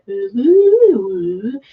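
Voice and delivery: a hint of a hypnotic cuttlefish; hypnotic cooing cuttlefish. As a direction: coo slow to entrance the fish. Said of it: "entrances the fish and goes".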